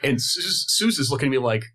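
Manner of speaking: usual speaking voice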